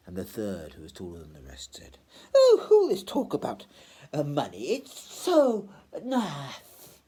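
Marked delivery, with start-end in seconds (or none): 2.3-7.0 languid fop. Acted. The tall gentleman sneers at the poor, high and languid. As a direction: sigh it out in lofty boredom.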